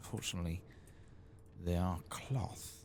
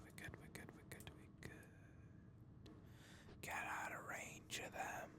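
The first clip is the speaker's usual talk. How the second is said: hushed, unvoiced whisper; sneaky whisper; 11 semitones lower, 10 dB quieter than usual. Player whispering to viewers while sneaking past Twilight Binders, hushed whisper. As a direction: whisper it as if creeping past someone.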